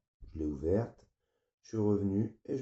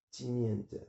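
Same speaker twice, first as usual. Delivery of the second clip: ordinary delivery, nothing marked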